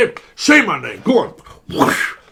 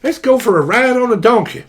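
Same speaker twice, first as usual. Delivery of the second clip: cowboy voice; 5 semitones higher, 5 dB louder than usual. Retold or imitated. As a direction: whoop it like a rodeo rider. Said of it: "It's like".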